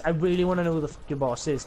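normal talking voice

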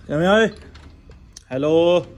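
loud high greeting to pigeons; calling out. Real, not acted. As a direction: call out cheerfully. (ਉੱਚੀ ਆਵਾਜ਼ 'ਚ ਪੁਕਾਰਨਾ)

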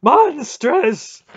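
very high, screaming bellow; explosive, appalled fury; outraged roar. A narrator's reading. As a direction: roar it out at the top of the voice. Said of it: "Mara roared".

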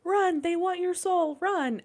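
high, slow, ghostly warning; eerie dream voice. Retold or imitated. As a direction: voice a spooky spirit urging her to flee. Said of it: "all I'm hearing is".

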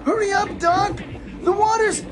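very high, urgent wail; panicked squeal. Acted. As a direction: squeal for help as the water heats. Water in pot getting hot.

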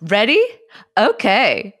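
high, loud put-on voice; bright mock host voice asking if everyone's ready; silly put-on voice. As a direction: chirp it out high and loud, playing a bit. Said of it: "Don't talk like that".

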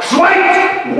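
high, booming Schweigt!; commanding shout. Acted. Teacher posing before the board, a grand high command. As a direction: proclaim like a prophet. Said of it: "rufe ich".

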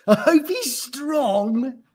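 high, loud, drawn-out wish; swooning female gorilla. A narrator's reading. Doris pictures the new male, swooning. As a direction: stretch out the longing.

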